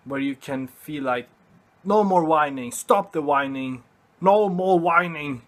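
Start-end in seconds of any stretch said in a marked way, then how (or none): 1.8-5.4 firm shout. Pretend. Self-talk shouting down whining.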